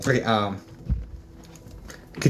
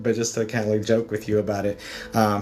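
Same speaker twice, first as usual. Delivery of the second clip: ordinary talk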